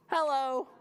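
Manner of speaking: lightly put-on greeting; talking puppy. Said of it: "Do dogs say hello?".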